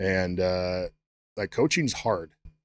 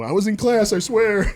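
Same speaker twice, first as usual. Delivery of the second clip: much higher, louder whiny plea; pleading excuse; 12 semitones higher, 7 dB louder than usual. Retold or imitated. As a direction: beg like a guilty student.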